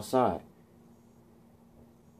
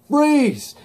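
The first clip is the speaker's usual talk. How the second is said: loud, high command; imitated officer shouting; acted police shout; 5 dB louder than usual. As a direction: yell it like an arresting officer. Voices police ordering him.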